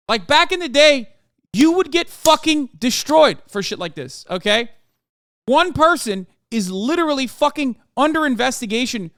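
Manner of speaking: angry rant. Real, not acted. Ranting at Twitter accusers.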